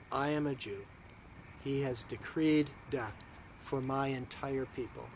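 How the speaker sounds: low, hushed accusation with long pauses; solemn accusation. Acted. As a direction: reveal it gravely and softly, piece by piece. Esther solemnly accuses Haman before king.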